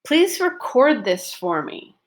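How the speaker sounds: "record" as a verb, long O in the stressed second syllable. Shown as a demonstration. Teacher models the verb in a request, stress on the end of "record". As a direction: lean on the "cord" of "record". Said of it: "stress is on syllable number two".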